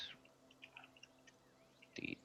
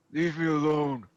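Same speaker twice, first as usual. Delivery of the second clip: put-on a touch of a gruff old priest; lightly voiced abbot, waving off; the father's voice; 5 semitones higher, 6 dB louder than usual. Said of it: "give me your impression of the father".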